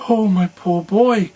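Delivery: put-on a kind woman wailing for the poor boy; pitying cry. A narrator's reading. Giant's wife pities Jack, a slow, high pitying cry. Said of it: "cried the woman".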